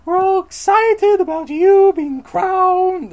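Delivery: squealing high falsetto monster voice; hammed-up giddy monster; excited monsters. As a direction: gush loudly in a silly falsetto. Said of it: "all the other monsters are like".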